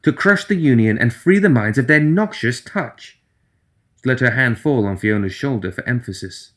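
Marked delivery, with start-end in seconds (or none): 0.0-3.2 higher, eager voice; earnest, bright-eyed persuasion; Nelly's voice. Acted.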